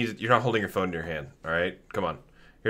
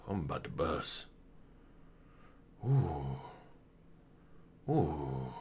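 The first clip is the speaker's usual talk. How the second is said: very soft, low hush; hushed sneaking; 7 semitones lower, 16 dB quieter, slower than usual. Pretend. Gamer creeping up on a character, hushed and low.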